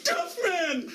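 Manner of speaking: excited. Acted. Old impression clip.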